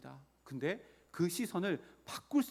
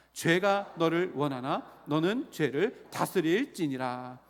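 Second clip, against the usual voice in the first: loud, measured read-aloud for the congregation; leading scripture reading; 7 dB louder, slower than usual. Real, not acted. Preacher leads congregation through Bible verses, loud deliberate reading. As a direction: declaim the verse slowly and loudly in phrases.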